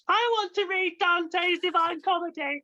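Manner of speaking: mock quote. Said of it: "I think I mentioned".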